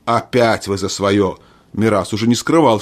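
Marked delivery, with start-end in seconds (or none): none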